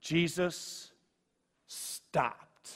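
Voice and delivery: softly, fading to a whisper; hushed reverent. Acted. Preacher savoring the moment Jesus stopped, hushed. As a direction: let the words land in awe. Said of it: "Two of the most grace-filled words".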